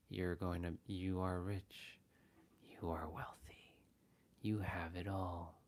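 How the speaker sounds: soft, whispery fake affirmation; fake subliminal voice. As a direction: imitate a creepy subliminal. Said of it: "I don't like this person's voice".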